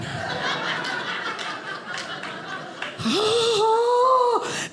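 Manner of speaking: long high gasp of outrage; women gasping at calm down; shocked gasp. Pretend. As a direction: gasp as if insulted.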